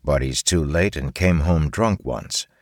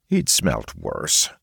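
plain reading voice

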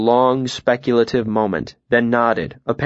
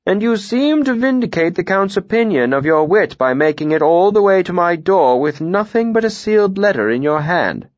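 high-pitched voice of a lordly mayor; commanding mayor; 7 semitones higher than usual. Acted. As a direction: play the mayor weighing up a new arrival.

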